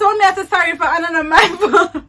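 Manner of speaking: loud, laughing and high; laughing protest. Retold or imitated. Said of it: "I said".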